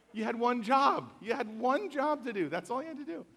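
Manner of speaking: high, exasperated one-job scolding; mock scolding. Pastor mock-scolds the meme maker, high and exasperated. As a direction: scold it like a classic meme fail.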